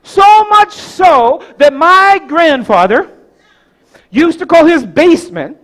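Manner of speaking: high, loud, emphatic pulpit delivery; preach. Acted. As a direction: proclaim each phrase, with big pauses between. Preacher storytelling at full force.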